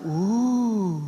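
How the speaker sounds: drawn-out imitated "oooh" of amazement; mock crowd "oooh". Preacher mimicking people wowed by miracles, mock amazed ooh. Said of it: "no fue que la gente diga".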